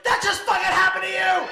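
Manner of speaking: high, loud gleeful yell; excited shout. Retold or imitated. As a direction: shout it in disbelief at him. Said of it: "my friend ran up to him and was like".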